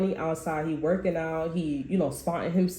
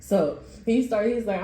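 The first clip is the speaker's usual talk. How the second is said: normal talking voice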